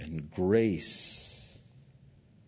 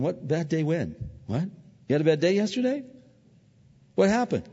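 higher, bewildered questions; puzzled Heavenly Father; 7 semitones higher than usual. Retold or imitated. Heavenly Father puzzled by a forgiven confession, higher. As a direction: ask as if it's all forgotten. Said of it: "your Heavenly Father's going".

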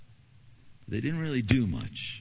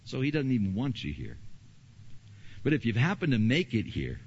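usual speaking voice

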